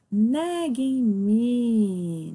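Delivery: "nagging" stretched out slowly; slow, careful "nagging me" for learners; teacher modelling "nagging" to the class, drawn out; slow model "nagging". Shown as a demonstration. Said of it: "would be like".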